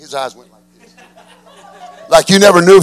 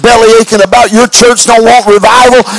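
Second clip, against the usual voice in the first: loud, stern rebuke; preacher scolding; 5 semitones higher, 7 dB louder than usual. Retold or imitated. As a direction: scold him like an angry pastor. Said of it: "He said".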